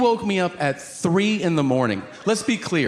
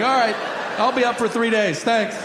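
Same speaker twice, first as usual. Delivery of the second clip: higher, exasperated sarcasm; sarcastic shaken; 7 semitones higher than usual. Retold or imitated. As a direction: thank him through gritted panic.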